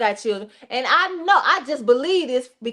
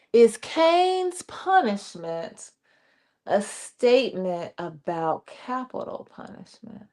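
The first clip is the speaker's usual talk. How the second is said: much slower, letting each word land; slow, weighty reread; 5 semitones lower, 4 dB quieter, slower than usual. Shown as a demonstration. Host rereads the listener's question slowly. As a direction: repeat it slowly so it sinks in. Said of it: "y'all chime in on this for Vernon".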